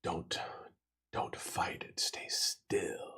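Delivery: eerie hooded figure whisper. Acted. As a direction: breathe it out, barely voiced. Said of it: "you can hear this figure just kind of say".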